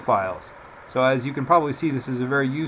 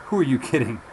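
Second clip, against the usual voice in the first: Cary Grant imitation; 7 dB louder, faster than usual. Shown as a demonstration. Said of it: "it's recording me now saying".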